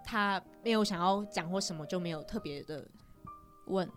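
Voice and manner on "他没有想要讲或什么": normal talking voice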